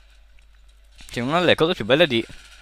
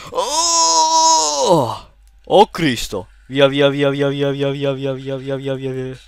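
grido acuto poi via ripetuto in fuga; being chased; 4 semitones higher, 5 dB louder, much slower than usual. Real, not acted. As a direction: scappa urlando via. Inseguito dagli assassini. (inseguito, in fuga)

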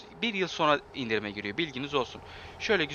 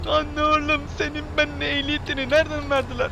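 much higher, loud mocking yell at the driver; 14 semitones higher, 7 dB louder than usual. Real, not acted. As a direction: scold the bad driver in a high squeal. Yelling at a bad driver. (sürücüye tiz ve yüksek sesle alaycı bağırış)

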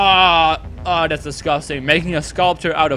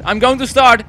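higher and louder; louder threat; 6 semitones higher, 5 dB louder than usual. Shown as a demonstration. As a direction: say it as a warning.

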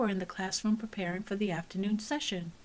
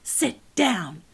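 loud firm command; ordering; 7 dB louder than usual. Acted. Teacher ordering Cassie to sit down, firm. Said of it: "she ordered".

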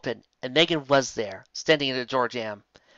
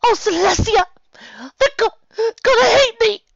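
high, loud wailing sob; bawling; 9 dB louder than usual. Acted. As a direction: wail it out, stuttering through tears. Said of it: "she bawled out, stuttering".

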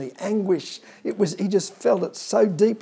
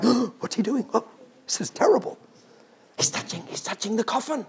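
gasping, fast scandalised whisper; shocked gasp; 4 semitones higher, 6 dB quieter, faster than usual. Retold or imitated. Onlookers shocked as Jesus touches the coffin, gasping. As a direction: clutch pearls at the scandal. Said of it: "they go".

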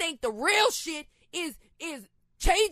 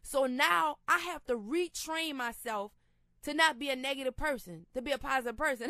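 usual speaking voice

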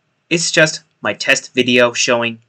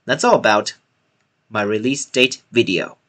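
ordinary talk, nothing marked

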